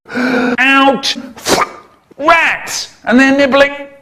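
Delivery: shouting. Acted. Shouted panic lines.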